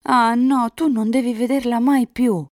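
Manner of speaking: high, loud outburst of refusal; vehement protest. A narrator's reading. Elisa forbidding Enrico to see her rival, loud protest. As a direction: burst out loudly, forbidding it.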